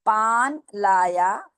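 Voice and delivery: पान लाया read slowly, word by word; slow teacher model of पान लाया. Shown as a demonstration. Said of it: "मैं पढ़ के सुनाती हूँ".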